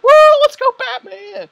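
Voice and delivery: tiz, çok yüksek sesli tezahürat; kalabalık gibi coşkuyla bağır; cheering shout. Retold or imitated. Audience cheering retold.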